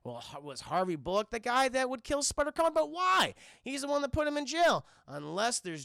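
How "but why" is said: normal talking voice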